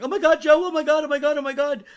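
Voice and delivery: very high, loud wailing on one pitch; mock frantic background screaming; imitated hysterical sonographer. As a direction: shriek in nonstop panic. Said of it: "In the background, I can hear the sonographer".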